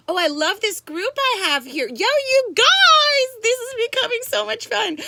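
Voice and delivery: high, loud gushing to the group; excited cheering. Real, not acted. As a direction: shout your delight to the whole gang. Cheering on her live group.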